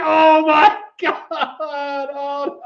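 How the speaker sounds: high, tearful words breaking up into laughter; crying with laughter. Real, not acted. Host reading back the absurd wrong name, crying with laughter. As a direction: laugh till you cry while talking. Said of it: "I am crying, I'm laughing so hard".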